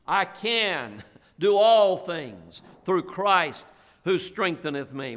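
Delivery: each word of "I can do all things through Christ" laid down slowly; slow memory-verse recitation with weight on "all"; slow, deliberate recitation. Shown as a demonstration. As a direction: say it slowly, as if helping them memorize. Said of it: "That's a good verse to memorize".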